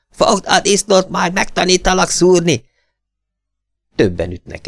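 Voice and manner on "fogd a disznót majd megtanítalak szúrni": triumphant roar. A narrator's reading. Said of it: "Diadalmas ordítás".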